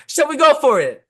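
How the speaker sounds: high, loud diva proclamation. Retold or imitated. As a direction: belt it like a power ballad diva.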